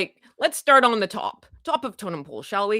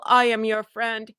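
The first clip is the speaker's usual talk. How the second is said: ordinary talk, nothing marked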